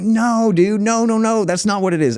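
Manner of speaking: a hint of exasperated pleading; imitating protest. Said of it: "And he's like".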